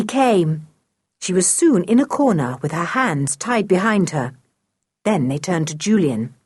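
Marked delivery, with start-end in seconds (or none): none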